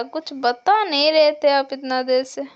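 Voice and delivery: normal talking voice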